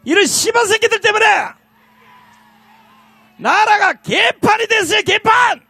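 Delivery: high, loud, furious yell; roaring rally curse; furious shout. Real, not acted. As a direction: let the anger explode at the crowd. Furious rally cursing at opponents.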